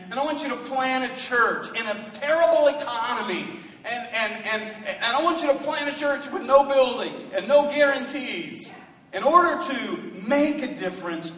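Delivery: high, loud, driving preacher's voice; full-force preaching. Acted. Pastor rousing his congregation about Noah, preaching loud and high. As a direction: preach it hard and lift the room.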